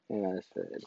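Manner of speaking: ordinary delivery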